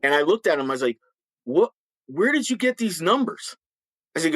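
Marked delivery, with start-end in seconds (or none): 1.4-3.5 high, disbelieving question; incredulous. Retold or imitated. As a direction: react in total disbelief. Incredulous retort to the major.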